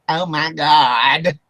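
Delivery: slow Southern country drawl on oh my God. Retold or imitated. Friend imitating teammate Dusty's Southern drawl. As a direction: stretch it out like a country Southern man. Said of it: "real country Southern drawl accent".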